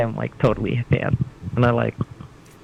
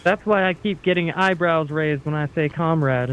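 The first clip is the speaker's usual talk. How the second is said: ordinary talk, nothing marked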